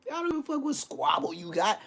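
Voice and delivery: high squeaky mock voice; high put-on voice.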